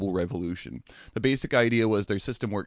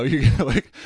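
ordinary talk

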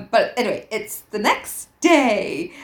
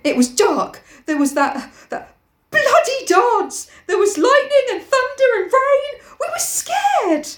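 building from raised pitch to a high wail; hammed-up panicky pleading, climbing higher and higher; frantic excuse; 3 dB louder than usual. Piggy excusing the dance to Ralph, frantic.

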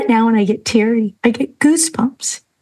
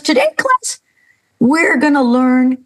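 teacher announcing; 3 semitones higher than usual. Retold or imitated. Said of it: "the teacher walks in and goes".

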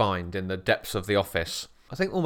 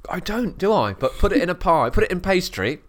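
excited; 6 semitones higher, 5 dB louder than usual. Real, not acted. Playful enthusiasm about pastry.

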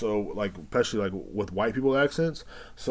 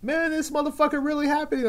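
much higher mock woman's voice; imitating the woman. Clerk imitating the customer's amazed friend, high. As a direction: squeak it out like her. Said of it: "And then she goes".

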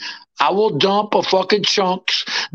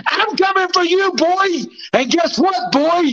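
taunt; 10 semitones higher than usual. Acted. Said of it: "He wanted some motherfucker like me saying".

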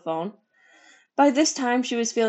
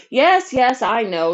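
quickly; 4 semitones higher, 9 dB louder, slower than usual. A narrator's reading. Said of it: "said Patsy's mother quickly".

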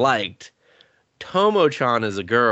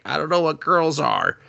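higher put-on voice, bewildered; mock clueless boy; 7 semitones higher than usual. Clueless hero answering the girl, in a put-on higher voice. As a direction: lightly play the dense hero. Hero's reply in anime retold.

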